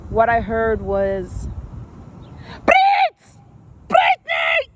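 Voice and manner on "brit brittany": high, loud, shrill yelled call of her name; imitating coach yelling. Coach yelling across the gym.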